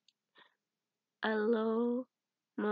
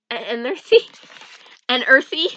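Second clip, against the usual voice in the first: louder incredulous questions; 10 dB louder than usual. Real, not acted. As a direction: question it in disbelief. Mocking misreading.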